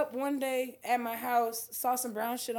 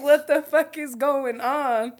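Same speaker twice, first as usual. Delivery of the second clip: mock bewildered; 2 semitones higher, 9 dB louder, much slower than usual. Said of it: "I'm over here like".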